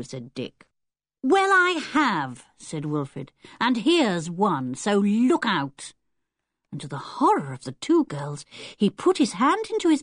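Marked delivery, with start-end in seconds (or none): 1.2-2.4 put-on slow, higher, stubborn boy; stubborn insistence. Boy insisting to older children he has friends, stubborn. 3.6-5.9 slowed down, teasing menace; slow taunting threat. Acted. Boy about to pull out a snake, slow mock menace.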